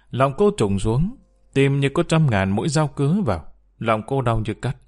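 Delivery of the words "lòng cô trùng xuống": plain reading voice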